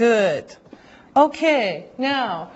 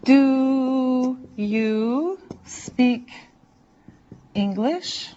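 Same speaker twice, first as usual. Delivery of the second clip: stretched-out question; much slower than usual. Shown as a demonstration. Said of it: "here's the question and how you say it".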